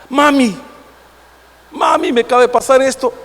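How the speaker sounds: lightly imitated child calling mother; Marco. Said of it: "¿cómo le dice Marco a usted?".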